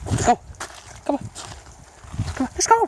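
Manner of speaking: high squeaky coaxing call. Real, not acted. Owner calling the husky to go, high baby talk.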